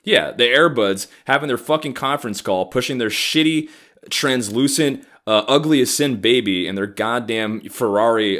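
usual speaking voice

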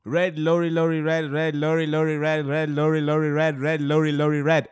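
loud, rapid-fire "red lorry, lorry red"; rapid tongue twister. Shown as a demonstration. Host proves he can say it quite fast, rapid chant. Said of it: "And you say it quite fast".

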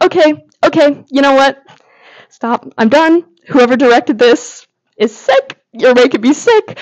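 playful protest at a heartbreaking scene; overwhelmed outburst. Retold or imitated. Recalling being overwhelmed by the scene.

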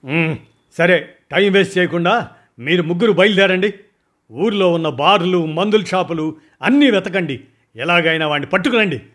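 high, loud, brisk commands; commanding orders. Acted. Boss sends his three men out to search, loud and commanding. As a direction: order them out at once. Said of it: "order వేశాను".

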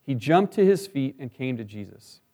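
measured Bible reading aloud; scripture reading. Acted. Scripture reading before sermon.

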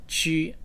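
qu set apart as a model syllable; pinyin Q demo. Shown as a demonstration. Teacher giving the word to go, demonstrating qu. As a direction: say qu with a clean ch-like start. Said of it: "where we can pronounce it".

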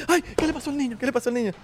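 high-pitched mock fussing; shrieky imitation of a fussing parent; panicking parent. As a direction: overreact like an alarmed parent. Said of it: "y los papás".

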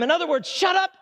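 fiery sermon climax; preaching at full force. Real, not acted. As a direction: drive it home to the congregation.

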